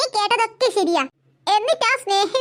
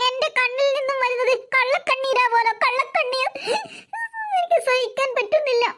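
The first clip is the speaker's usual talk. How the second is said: higher, whiny mock-tearful complaint; fake crying; 4 semitones higher than usual. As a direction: put on crocodile tears to win mother over. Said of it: "കള്ളക്കണ്ണീരൊന്നും".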